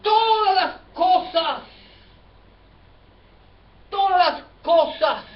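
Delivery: "Todas" held long and high, then a fall; high, ringing preacher's stress on "Todas"; emphatic proclamation. Real, not acted. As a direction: proclaim it slowly, leaning hard on "Todas". Preacher proclaims the repeated phrase.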